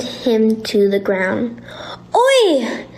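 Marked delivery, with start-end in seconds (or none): 2.1-2.9 much higher, squeaky protest; squeak. A narrator's reading. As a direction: squeal it like a pinned rat. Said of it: "squeaked the rat".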